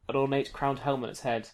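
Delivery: ordinary talk, nothing marked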